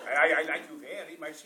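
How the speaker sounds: lightly imitated [name]-like accent. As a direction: playfully mimic a [name]-style accent. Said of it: "You sound more like [name]".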